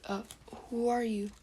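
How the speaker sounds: put-on little kid voice; little kid Naruto. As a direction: ask it as a small, puzzled child. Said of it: "trying to make a little kid voice".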